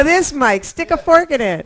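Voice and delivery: ordinary talk, nothing marked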